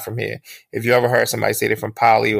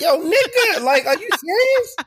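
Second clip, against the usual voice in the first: much higher-pitched mock outrage; incredulous. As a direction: call him out in high disbelief. Acted disbelief at fake accent.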